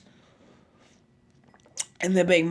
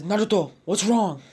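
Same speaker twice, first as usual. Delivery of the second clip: higher, louder call of concern; alarmed call; 4 semitones higher, 5 dB louder than usual. Acted. Brother running in to the sobbing boy, alarmed call. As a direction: call out to him, worried.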